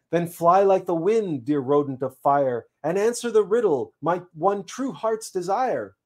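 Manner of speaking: earnest seed. Acted. Seed sends the squirrel to answer the riddle, earnest plea. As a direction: send the messenger off with lofty urgency.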